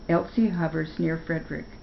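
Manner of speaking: ordinary delivery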